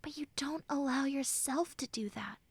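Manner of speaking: soft whispered reproach. Acted. Friend whispering a soft reproach to the listener. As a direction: whisper it like a gentle scolding. Gentle reproach while comforting.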